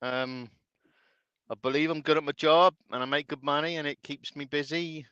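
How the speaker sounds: ordinary delivery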